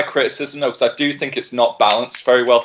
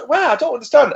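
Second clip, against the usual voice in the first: higher, mock-puzzled complaint; confused customer; 11 semitones higher than usual. Customer confused by the empty hook, higher mock bewilderment. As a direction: play baffled innocence.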